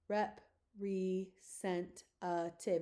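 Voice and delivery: representative broken slowly into rep, re, cent, a, tive; syllable by syllable. Shown as a demonstration. Teacher showing another syllable split, slow separated beats. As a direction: spell it out by sound, one slow beat each. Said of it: "you can do it out like that".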